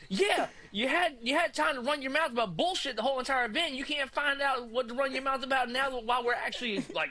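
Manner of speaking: mock angry rant at a sleepy passenger; imitating furious friend. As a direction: tear into him, a hammed-up rant. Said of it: "and Norris is like".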